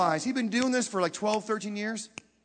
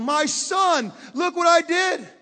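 desperate shout; 8 semitones higher, 5 dB louder, slower than usual. Retold or imitated. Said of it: "Just beating on it, going".

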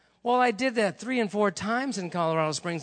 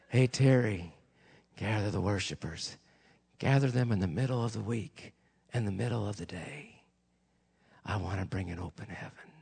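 low, soft voice of God; low and hushed, coaxing patiently; God's gentle call; 9 semitones lower, 5 dB quieter than usual. Retold or imitated. God calling the preacher to gather worshipers, gentle and low.

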